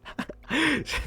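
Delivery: short high-pitched laugh. Real, not acted. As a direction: burst out laughing. Laughing at his own story.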